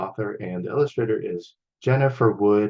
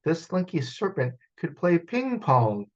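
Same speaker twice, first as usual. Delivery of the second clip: plain reading voice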